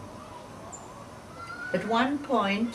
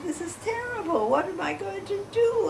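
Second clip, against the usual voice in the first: retold dismay; 10 semitones higher than usual. Telling Mommy about the phone call, in high-pitched dismay. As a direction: replay a worried plea, pitched well up.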